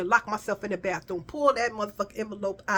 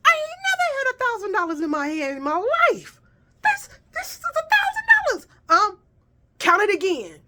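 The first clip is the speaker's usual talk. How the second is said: much higher, breaking squeal; squealing disbelief; 13 semitones higher than usual. Retold or imitated. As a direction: shriek with joy, almost crying. Hysterical joy over money.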